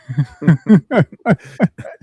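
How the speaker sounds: sudden high, loud burst of laughter; real loud laugh; big laugh. Real, not acted. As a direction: crack up loudly at your own point. Laughs at own joke.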